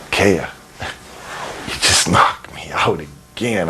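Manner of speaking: slow, dragged-out groggy complaint; acted dazed. Wrestler replaying his plea to his opponent Kea, slow and dazed. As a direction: drag the words as if knocked senseless. Acts himself knocked out mid-match.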